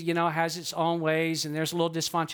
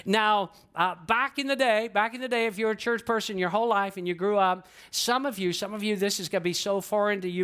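ordinary delivery

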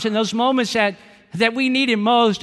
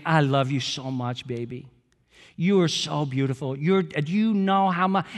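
put-on tender baby talk; 5 semitones lower than usual. Father cooing to his newborn daughter at night, tender and hushed. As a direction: melt over a newborn in your arms. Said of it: "talking to her as though she understands".

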